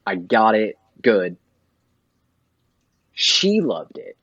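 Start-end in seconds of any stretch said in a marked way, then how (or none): none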